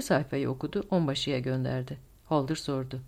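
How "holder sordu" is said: ordinary delivery, nothing marked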